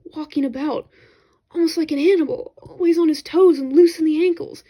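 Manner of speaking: Lawrence, admiring. Acted. Lawrence telling the narrator about Celliers, lightly voiced admiring. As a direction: voice the friend fondly remembering a soldier.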